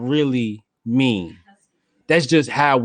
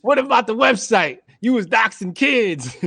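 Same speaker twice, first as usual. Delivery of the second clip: lightly voiced heckler, much higher; mocking heckler; 9 semitones higher than usual. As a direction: call her out like a heckler. [name] heckling continues.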